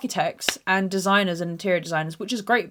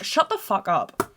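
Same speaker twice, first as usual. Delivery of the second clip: slow, deliberate "Shut the fuck up" at talking level; perfectly articulated; slower than usual. Retold or imitated. Daughter telling mum off on the boat, slow and articulated. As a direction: spell out "Shut the fuck up" word by word. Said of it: "I'm articulating perfectly".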